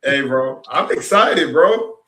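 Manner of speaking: ordinary delivery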